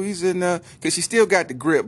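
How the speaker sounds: normal talking voice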